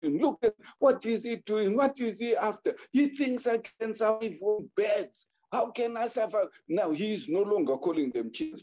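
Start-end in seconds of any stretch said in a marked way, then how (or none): 0.0-6.5 high, indignant yelling round the yard; mock shouting. Husband yelling round his yard, high-pitched mock shout. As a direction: rant to the neighbours, pitched high. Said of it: "He started shouting".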